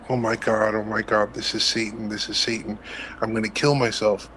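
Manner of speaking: usual speaking voice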